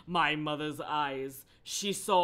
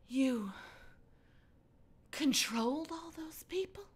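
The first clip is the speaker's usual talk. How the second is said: high, breathy whispered question; hushed disbelief; 9 semitones higher, 7 dB quieter than usual. Acted. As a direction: ask it softly, pitched up. Voices hushed shocked Julia.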